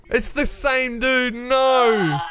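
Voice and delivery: high, slow, anguished whine; frustrated shriek. Real, not acted. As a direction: wail it out in disbelief. Killed again, shrieking in frustration.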